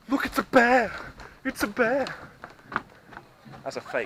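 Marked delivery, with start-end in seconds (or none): 0.1-2.1 high-pitched mock alarm at a bear. Pointing out a fake bear to a child, mock alarm. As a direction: cry out in playful alarm.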